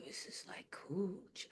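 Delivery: near-whispered awe, retold; awed hush. Feeling a wave of energy wash over her, awed hush. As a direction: drop to a hushed, barely audible murmur.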